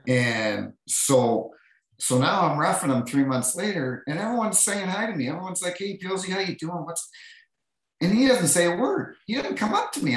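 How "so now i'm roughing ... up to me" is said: mounting mock outrage. Real, not acted. Indignant retelling about teammate.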